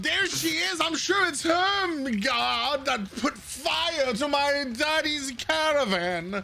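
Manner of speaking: high, shouted, drawn-out accusation from a pompous noble; shouting noble. Acted. Noble on the stoop, yelling at the crowd about Dahlia, in a high indignant voice. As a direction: point and yell at the culprit, slowly.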